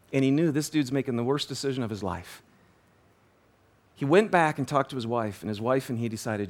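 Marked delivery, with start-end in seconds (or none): none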